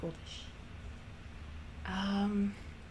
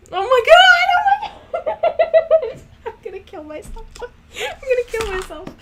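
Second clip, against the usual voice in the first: much higher and louder, laughing; shrieking laugh; 14 dB louder than usual. Real, not acted. Cracks up after failed accent.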